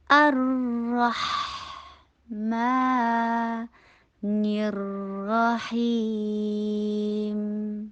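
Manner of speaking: sustained syllable-by-syllable Quran recitation; slow recitation. Shown as a demonstration. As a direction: recite slowly, holding each long vowel. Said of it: "اتنا ہی آہستہ پڑھنا ہے".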